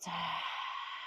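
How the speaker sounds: long open-mouthed sigh; exhale. Shown as a demonstration. Yoga teacher showing students how to release, audible exhale. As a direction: demonstrate a soft, airy letting-out of breath. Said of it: "exhale it out".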